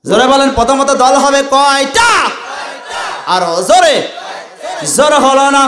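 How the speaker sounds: high, loud demanding call; loud preacher's call for a response; calling out to crowd. Real, not acted. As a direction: urge the crowd to shout back. Preacher demanding crowd answer loudly.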